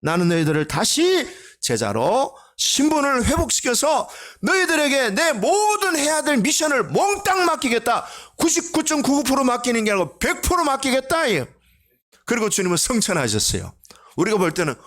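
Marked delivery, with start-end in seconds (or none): 0.0-11.2 high, ringing preacher's proclamation in Jesus's voice; preaching as Jesus. Acted. Pastor voicing Jesus to the congregation, fervent high proclamation. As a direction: proclaim it like the risen Lord rallying his men.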